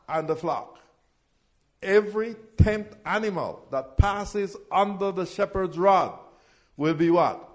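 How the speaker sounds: loud, driving call-and-response preaching; full-force preaching. Acted. Pastor calling the tithe verses to his congregation, loud preaching.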